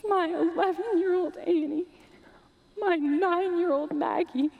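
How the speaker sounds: high, tearful voice breaking as she clings on; crying. Acted. Mother recalling the shipwreck that took her daughters, crying. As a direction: fight back sobs while telling it.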